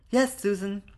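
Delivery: put-on high child voice with a lisp; lisping little girl. Little girl confirms guests have gone, childish lisp. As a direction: answer as an obliging lisping child.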